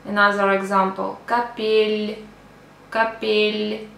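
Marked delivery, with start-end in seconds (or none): 1.3-3.9 капель ending in a light palatal "l"; soft final L. Shown as a demonstration. Teacher giving another example, soft L. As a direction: soften the L closing капель. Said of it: "makes, um, a consonant soft".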